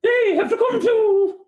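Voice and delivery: slow, very high raptor screech; velociraptor speaking English. Acted. Raptor creature speaking up for its followers, velociraptor screech. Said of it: "like a, a velociraptor trying to speak English".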